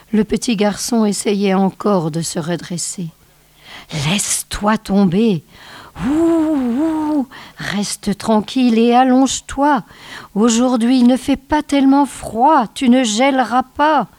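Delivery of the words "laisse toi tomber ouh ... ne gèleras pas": calling out. Acted. Snow child shouting to the fallen boy through the blizzard, higher and urgent. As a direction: shout it across the wind to the boy. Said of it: "cria pour la troisième fois".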